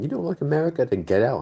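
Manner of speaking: high-pitched mock scolding; mocking objector. Critic telling the streamer to leave, high mocking voice. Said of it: "Anyone tells me, it's like".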